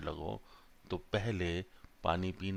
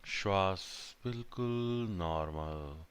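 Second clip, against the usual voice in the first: slow, drawn-out meditation voice with long pauses; guided meditation; 4 dB quieter, slower than usual. Real, not acted. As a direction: guide them calmly through each breath, unhurried. Leads a breathing meditation. (धीमी, शांत ध्यान कराने वाली आवाज़)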